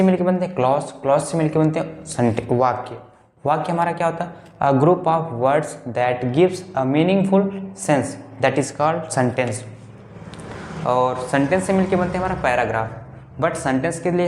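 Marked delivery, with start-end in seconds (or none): 4.6-9.6 slow dictation. Shown as a demonstration. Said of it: "teacher repeats definition".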